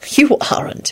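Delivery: mildly stern contradiction; understated firm reassurance; Lupin, stern. A narrator's reading. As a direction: contradict gently but firmly. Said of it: "he said sternly".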